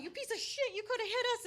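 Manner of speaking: high, loud enraged yell; retold yelling. Yelling at the driver who almost hit them, enraged. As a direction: scream it while slamming his car. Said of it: "yelling at him".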